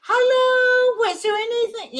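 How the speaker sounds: high, loud, sugary fake greeting; mock sweet voice. Mimicking the gal greeting the new patient sweetly.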